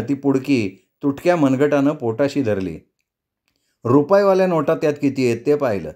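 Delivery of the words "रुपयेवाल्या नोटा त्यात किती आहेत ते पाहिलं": ordinary delivery, nothing marked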